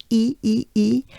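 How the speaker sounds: "E" with a wide smile, a bright French é; banana smile E. Shown as a demonstration. Teacher showing the banana smile E. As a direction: spread the lips wide for "E". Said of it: "I refer to as the banana smile".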